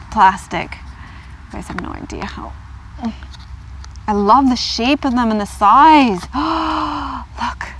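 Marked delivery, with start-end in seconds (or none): 6.3-7.4 a quick, high gasp; sharp gasp of delight. Real, not acted. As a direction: catch your breath in wonder. Sees the cards.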